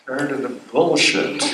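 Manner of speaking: ordinary delivery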